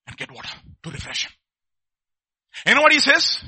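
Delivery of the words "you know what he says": loud rhetorical question. Real, not acted. Preacher rousing the congregation.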